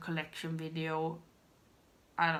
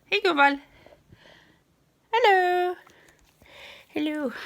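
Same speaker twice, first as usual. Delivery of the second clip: high, loud greeting call; loud, high hello to someone off camera; 12 semitones higher, 12 dB louder than usual. Real, not acted. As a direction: call across the room, much higher. Calling out to someone nearby.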